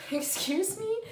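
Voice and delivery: high, loud, rising disbelief; mock indignation. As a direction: throw it out as an affronted question. Acted offended reaction to gossip.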